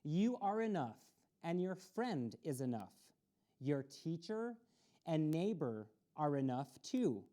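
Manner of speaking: plain reading voice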